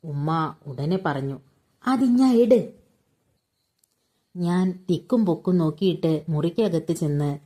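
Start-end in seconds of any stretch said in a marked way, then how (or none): 1.9-2.7 eager demand. A narrator's reading. Mother instantly demands the note.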